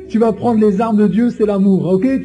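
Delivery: ordinary delivery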